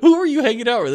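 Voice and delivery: teasing a friend back from Thailand; mock surprised question. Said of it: "I'm like".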